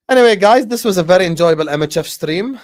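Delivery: loud sign-off. Real, not acted. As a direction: boom out the closing line. Wrapping up the stream.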